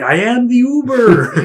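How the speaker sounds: high, slow booming announcement; mock-grand announcement of being the Uber; hammed-up driver. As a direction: declare it grandly and slowly. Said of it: "he was like".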